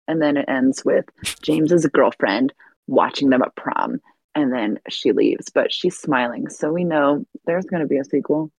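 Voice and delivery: ordinary talk, nothing marked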